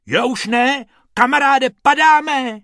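bunny yelling that they're falling; alarmed rabbit. Acted. Bobek cries out as the hat drops, high and loud.

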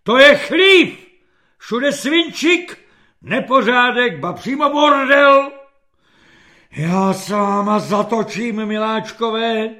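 loud, high dressing-down; general's tirade. A narrator's reading. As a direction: bawl out the whole kitchen like an outraged general. General's tirade in the filthy kitchen. (rozzuřený generálský řev)

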